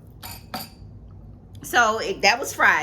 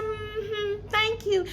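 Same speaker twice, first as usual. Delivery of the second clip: mock polite thanks; 9 semitones higher, 6 dB quieter, much slower than usual. Retelling her own polite reply.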